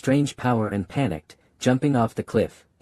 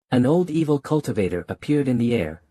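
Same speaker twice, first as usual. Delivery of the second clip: ordinary delivery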